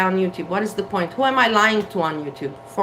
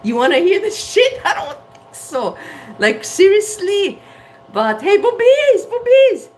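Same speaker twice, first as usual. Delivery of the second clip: high, loud put-on silly voice; goofy silly voice; 10 semitones higher, 5 dB louder than usual. Vlogger goofing for viewers in a silly voice. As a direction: ham it up like a giddy kid.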